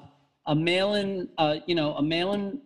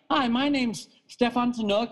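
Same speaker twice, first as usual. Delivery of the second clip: script-reading phone voice; 5 semitones higher than usual. Retold or imitated. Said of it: "they give you these little scripts".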